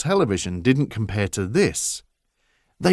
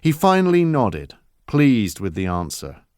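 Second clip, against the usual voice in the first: plain reading voice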